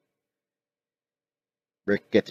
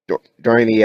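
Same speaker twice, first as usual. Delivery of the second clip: plain reading voice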